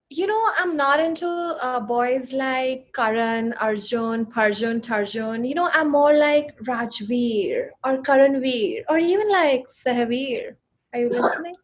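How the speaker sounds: put-on slow, drawling South Delhi accent; posh indian accent. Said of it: "in a South Delhi accent".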